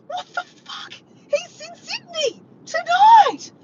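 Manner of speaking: high shriek building to a loud, drawn-out finish; yelling. Retold or imitated. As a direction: re-enact a stunned, frantic yell. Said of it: "And I'm yelling".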